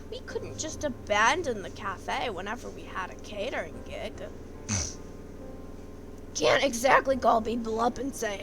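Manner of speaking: even storyteller narration; story narration. A narrator's reading. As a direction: read it as the narrator.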